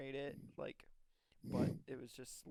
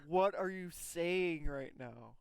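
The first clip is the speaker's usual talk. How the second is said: higher and louder, slow disbelieving what; mock-baffled guy who can't believe his ears; incredulous bro; 4 semitones higher, 7 dB louder, much slower than usual. Guy across the table reacting in disbelief, higher and louder. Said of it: "is like".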